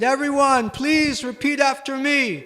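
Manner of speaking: high, loud call to the crowd. Retold or imitated. Ramanuja calling the gathered crowd to repeat, high call. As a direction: summon everyone to join in.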